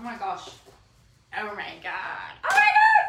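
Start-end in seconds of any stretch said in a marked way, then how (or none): 2.4-3.0 excited squeal. Real, not acted. First sight of her book.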